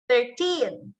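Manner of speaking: put-on "thirteen" with a long, stressed teen. Shown as a demonstration. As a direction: lean on the second syllable of "thirteen". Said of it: "you give stress to the word teen".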